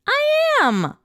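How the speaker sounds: very high, loud scream. Acted. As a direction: shriek it at the top of your voice. Being dunked, screaming.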